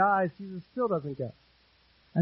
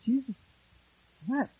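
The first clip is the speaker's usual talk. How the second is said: onlookers' remark; 5 semitones higher, 4 dB quieter than usual. Retold or imitated. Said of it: "They're like".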